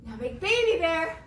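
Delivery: ordinary talk, nothing marked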